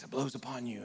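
low, soft mystical voice; ethereal. Retold or imitated. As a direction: float it like a wise sage. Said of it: "Jesus gets real ethereal".

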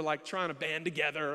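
ordinary delivery

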